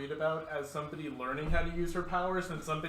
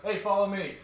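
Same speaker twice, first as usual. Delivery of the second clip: raised, slightly louder shout to follow; calling out; 3 semitones higher, 6 dB louder than usual. Acted. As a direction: call someone after you. Acted call to draw someone away.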